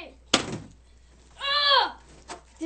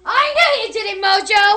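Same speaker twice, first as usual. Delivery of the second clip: furious accusing yell, higher and much louder; angry shout; 7 semitones higher, 13 dB louder, faster than usual. Pretend. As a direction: scream the accusation at Mojo. Villain accusing the robot.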